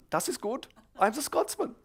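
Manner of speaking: high-pitched, pleased German teacher; mock German accent. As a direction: confirm it in a put-on German accent. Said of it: "everyone thought I was a German".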